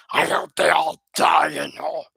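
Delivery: a demonic shout with an alien timbre; mock demon shout; demonish. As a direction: roar it like a demon cursing them. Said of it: "that's kinda a bit demonish".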